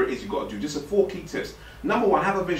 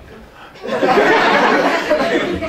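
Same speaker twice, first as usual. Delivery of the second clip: burst of laughter; 5 dB louder than usual. Real, not acted. Laughs at own joke.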